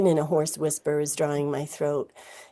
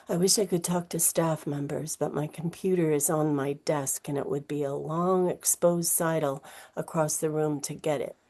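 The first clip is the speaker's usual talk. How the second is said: plain reading voice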